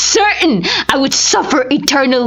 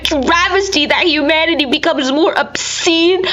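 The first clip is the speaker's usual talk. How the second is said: high, strained voice close to breaking, crying through the words; anguished lament; 6 semitones higher than usual. A narrator's reading. Narrator mourning his ruined body, voice breaking with anguish. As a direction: let the grief crack through as he describes what he has become.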